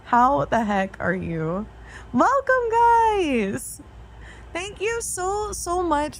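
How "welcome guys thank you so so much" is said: excited. Real, not acted. Greeting raiders on stream.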